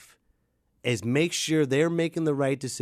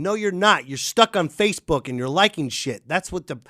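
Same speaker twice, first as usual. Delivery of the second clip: usual speaking voice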